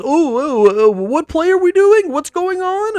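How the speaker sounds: high, dithering mock of a clueless coach; mock confused coach. Vlogger mocking the coach wandering the sideline, high dithering voice. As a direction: play the lost coach, voice climbing high.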